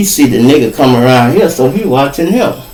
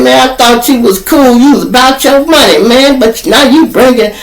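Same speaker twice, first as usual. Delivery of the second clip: raised, aggrieved lecture; scolding dealer; 8 semitones higher than usual. Retold or imitated. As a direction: pitch up and scold him for bringing folks. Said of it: "He said".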